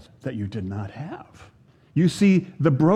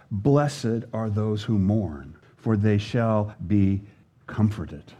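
scripture reading; 5 semitones lower, slower than usual. Acted. Reading the beatitude aloud.

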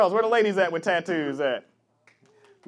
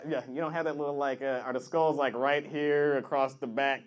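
normal talking voice

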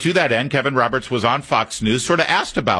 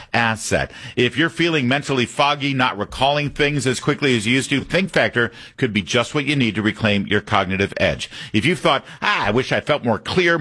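punchy product pitch, stressing the name; ad read; 4 semitones higher than usual. Acted. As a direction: read it like a radio spot. Host reads sponsor ad.

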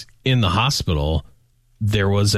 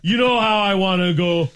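high, hammy Canadian "eh"; hammed-up Canadian accent; 10 semitones higher than usual. Joking about a Tim Hortons death, hammy Canadian accent. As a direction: play a cheerful Canadian, pitched up. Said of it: "As a Canadian?".

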